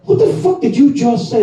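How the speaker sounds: high, incredulous mock demand; wife's outrage. Wife confronts him, high mock outrage. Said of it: "She's like".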